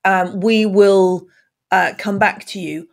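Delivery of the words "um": ordinary talk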